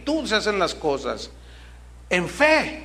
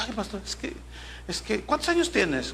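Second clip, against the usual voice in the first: a pastor imitating a nervous teenage girl, lightly voiced; hesitant, jittery girl asking her pastor; 6 dB quieter than usual. A pastor retells a frightened girl asking for help, mildly nervous. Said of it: "estaba así bien nerviosa".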